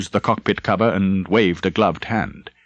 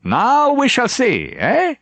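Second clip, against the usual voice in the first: high, slow knowing remark; slow high character voice; slower than usual. A narrator's reading. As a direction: draw it out, pitched up and wry. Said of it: "Leitner remarked".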